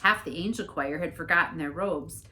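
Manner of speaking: plain reading voice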